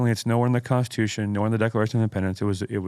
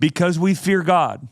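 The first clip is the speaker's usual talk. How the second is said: slow, raised, louder oratory; emphatic quote reading; 7 semitones higher, 4 dB louder, slower than usual. Acted. Host drives Spurgeon's quote home to co-host, emphatic oratory. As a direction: declaim it like a climax. Climax of the quotation.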